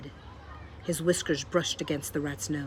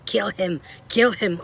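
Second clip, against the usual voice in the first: louder, building urging shout; 4 semitones higher, 8 dB louder, slower than usual. A narrator's reading. Botticelli urging a kill, shouting louder. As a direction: egg them on, louder each time. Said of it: "shouted Botticelli again".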